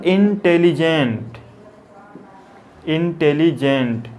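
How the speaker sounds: Intelligent slowly, as इन-टे-लि-जेंट; syllable by syllable. Shown as a demonstration. Teacher dictating a long adjective, intelligent slowly syllable by syllable. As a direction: stretch and separate each syllable of intelligent.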